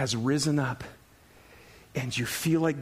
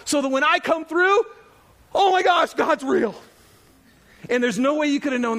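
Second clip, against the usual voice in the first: impassioned preaching; 8 semitones higher, 5 dB louder than usual. Acted. Preacher voicing God's reason for challenges, building fervour. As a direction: climb higher and louder to an amazed peak.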